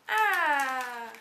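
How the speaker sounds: a sudden loud surprised "Ah!". Real, not acted. Opening the swap gift, loud surprised gasp of joy. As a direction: blurt it out as the gift is revealed.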